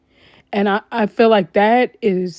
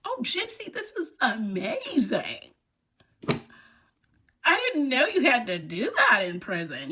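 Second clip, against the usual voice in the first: mock gushing; 3 semitones higher, 8 dB quieter than usual. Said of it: "Melissa Moore just said".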